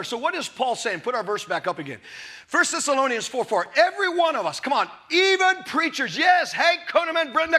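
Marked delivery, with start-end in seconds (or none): none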